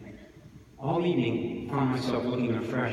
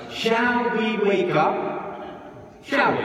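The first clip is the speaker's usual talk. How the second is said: slow, drawn-out shout, much higher; shouting priest; 11 semitones higher, 6 dB louder, much slower than usual. A narrator's reading. Priest shouting at the congregation, slow bellow. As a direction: bellow it slowly to wake them.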